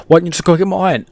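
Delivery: exasperated nagging voice; exasperated questioner. Acted. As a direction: fire the question off impatiently. Voicing the other side of argument.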